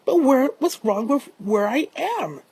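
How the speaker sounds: hurt, insistent questioning; indignant protest. Acted. Toby protests at being turned away.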